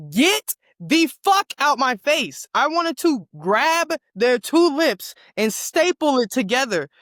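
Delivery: loud, high, slowed rant; angry outburst. Real, not acted. Furious at a friend spoiling the anime. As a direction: bite every word out in fury.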